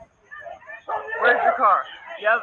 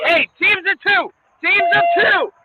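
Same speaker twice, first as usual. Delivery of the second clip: raised, urgent orders; commanding shout; 5 semitones higher, slower than usual. Real, not acted. Officer orders crowd to the wall, shouting. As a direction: call the teams over the noise.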